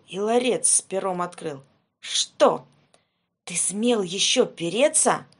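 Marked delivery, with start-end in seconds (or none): 2.0-5.3 angry tsar. Acted. Tsar rages at Ivan.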